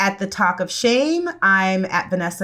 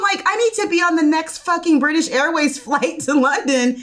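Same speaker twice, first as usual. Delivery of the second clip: higher-pitched, hammed-up incredulity; excited disbelief; 6 semitones higher than usual. Friend desperate to fly to London, excited disbelief. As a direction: get carried away, pitch riding high.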